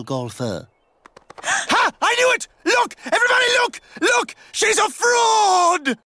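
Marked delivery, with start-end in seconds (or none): 1.4-6.0 high shouted accusation; accusing shout. Acted. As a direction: yell it out to everyone. Exposing a fraud to crowd.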